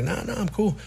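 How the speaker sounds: mock-casual young boy's refusal; imitating his younger self.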